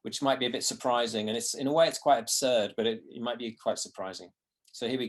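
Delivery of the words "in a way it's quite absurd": ordinary delivery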